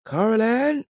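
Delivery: high call across the camp. Acted. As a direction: call her name loudly. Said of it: "Farrell called out".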